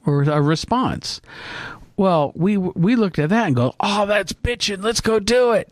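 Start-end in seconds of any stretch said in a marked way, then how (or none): 3.8-5.7 put-on higher-pitched gung-ho teenage enthusiasm; eager reaction. Storyteller quotes himself and brother keen to try it, eagerly. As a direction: imitate excited teens jumping at an idea.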